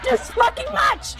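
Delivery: a very high, breaking shout into a laugh; frustrated shout. Real, not acted. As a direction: let it all burst out, half scream half laugh. Exploding at the broken stream.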